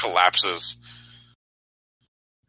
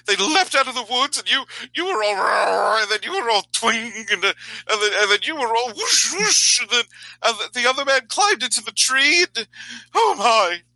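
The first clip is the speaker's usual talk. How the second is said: high, gushing excited storyteller; breathless fan telling the battle; excited bard voice. Acted. NPC gushes about the fight.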